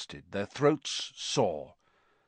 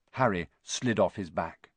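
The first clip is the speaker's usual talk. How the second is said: ordinary delivery, nothing marked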